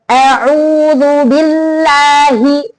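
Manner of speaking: slow, pressing the double l in "billahi"; teacher's slow demo, double l of "billahi" held; stress the tasydid. Shown as a demonstration.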